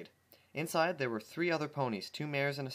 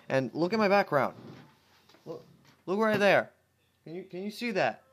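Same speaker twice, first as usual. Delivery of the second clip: excited; 5 semitones higher, 6 dB louder than usual. Real, not acted. Showing off his background excitedly.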